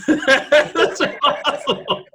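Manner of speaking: laughing through the words, slowed right down. Real, not acted. Guest laughing at his own past disbelief, slow laughing repeat.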